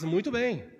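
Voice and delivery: normal talking voice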